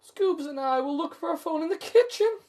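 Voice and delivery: high, loud Shaggy impression. A narrator's reading. Reader voicing Shaggy to the listeners, high and eager. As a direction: announce it like Shaggy splitting off. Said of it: "Shaggy announces".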